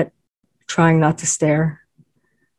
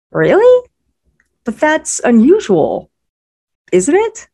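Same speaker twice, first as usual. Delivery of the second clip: much higher, loud disbelief on Really; pressing surprise; 10 semitones higher, 4 dB louder than usual. A narrator's reading. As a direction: press her in shocked disbelief. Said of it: "I pressed".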